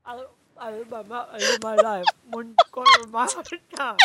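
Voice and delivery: talking through a mouthful of water; water held in mouth. Shown as a demonstration. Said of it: "put some water in my mouth and try this".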